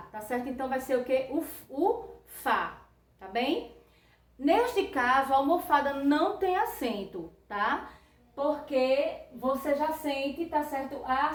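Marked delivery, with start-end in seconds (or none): none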